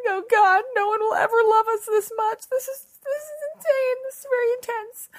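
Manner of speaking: loud, high-pitched mock wailing; hammed-up sobbing. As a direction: wail it like you're bawling in a friend's arms. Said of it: "sobbing, clutching each other, being like".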